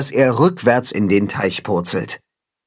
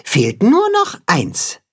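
hinting; 10 semitones higher, slower than usual. Acted. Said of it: "sagt das Katzenhuhn".

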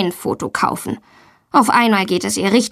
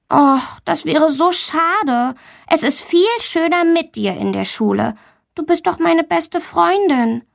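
put-on higher, pleading young friend; sweetly sorry, higher-pitched; disappointed coaxing; 7 semitones higher than usual. A narrator's reading. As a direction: regretfully coax your best friend. Friend is sorry, coaxes her.

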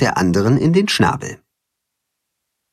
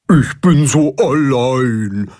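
langsam, etwas lauter, den Kummer herauslassen; herausgeplatzt traurig; slower than usual. Acted. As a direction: mit den Tränen kämpfend herausplatzen. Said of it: "platzt es aus dem Karpfen heraus". (Karpfen platzt traurig heraus, den Tränen nah)

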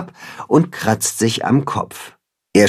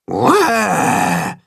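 higher little-animal roar; demonstrated roar; 3 semitones higher than usual. A narrator's reading. Tacho shows Willi how to roar, demonstrating. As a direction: give a small mock roar, drawn out. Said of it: "macht Tacho ihm vor".

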